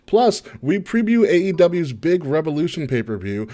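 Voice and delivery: upbeat announcer promo; podcast promo read. Acted. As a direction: sell the episode like a radio host. Reads show promo.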